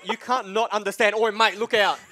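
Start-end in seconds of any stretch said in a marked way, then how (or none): 1.1-2.0 hint of a broad Australian warning call; lightly hammed Australian call-out, a bit louder; own accent exaggerated. Caster showing how his accent sounds to a teammate. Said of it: "understand my accent".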